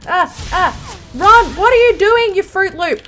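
high, loud scolding; exasperated yell. Real, not acted. Player yells at Ron for messing up, exasperated. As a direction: yell at the useless teammate.

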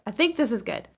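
usual speaking voice

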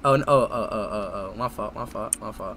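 very low, hushed backing down; small, repeated murmur of apology; sheepish apology. Acted. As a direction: shrink away, barely audible. Backing down after a blunder.

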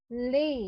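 লে with a gliding ey ending; correct pronunciation. Shown as a demonstration. Teacher models the word lay with a picture. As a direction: let লে slide into ay. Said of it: "L A Y".

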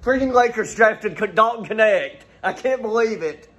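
can't contain it, the news just broke; real excitement. Real, not acted. Fan hearing his team drafted the player, real excitement.

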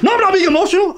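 high, loud, strained outburst; shrill-pitched shouted imitation of a man losing it; mock screaming protest. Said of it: "why are you screaming like this".

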